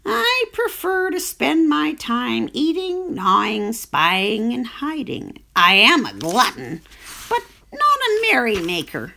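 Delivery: a sly glutton bragging to the pig; greedy crafty rat. Acted. Rat voiced in story.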